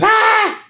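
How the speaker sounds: very high, loud scream; acted piercing shriek; mock scream. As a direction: scream in cartoon horror. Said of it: "And he screams".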